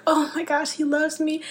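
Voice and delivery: high, light, awed realization; touched amazement. Acted. Believer realizing God loves her, in mild, high-pitched amazement. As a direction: act out wonder at being loved, voice lifted. Acted moment of feeling loved.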